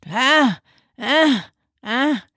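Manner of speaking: put-on high, short bursts of baby crying. Shown as a demonstration. Speech therapist demonstrating a baby's burst cry, high and choppy. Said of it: "the cry is more of a burst".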